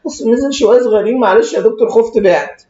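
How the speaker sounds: high mocking whine of a frightened seller; whiny client excuse. Doctor mimics client apologizing after selling in fear, whiny. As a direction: imitate him fearfully apologizing. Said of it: "يقول لي".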